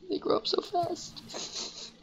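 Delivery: high, slow, breathy whimper of a proud parent; mock weepy parent. Gamer to viewers about friend starting a channel, mock weepy. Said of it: "I'm gonna cry".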